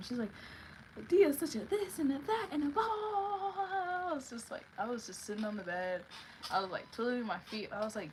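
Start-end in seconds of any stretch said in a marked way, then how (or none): 1.1-4.1 high-pitched imitation of a ranting roommate; mocking rant dissolving into blahs; mock yelling roommate. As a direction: mimic her complaint in a squealed put-on voice. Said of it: "she's, like, yelling".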